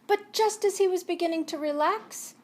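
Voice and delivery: ordinary delivery, nothing marked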